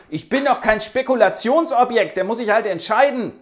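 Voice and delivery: high, loud indignant snap; indignant retort. Acted. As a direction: huff at being treated as a bargain. Empörte Frauenstimme.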